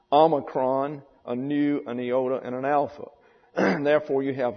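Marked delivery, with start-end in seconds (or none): none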